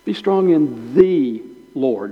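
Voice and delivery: slow line with the stretched to "thee"; emphasize the, as the one and only; stress on the. Shown as a demonstration. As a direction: lean on the, pronounced "thee". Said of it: "Not a Lord".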